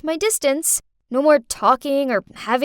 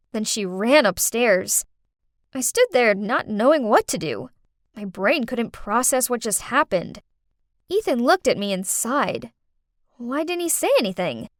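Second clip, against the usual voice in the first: usual speaking voice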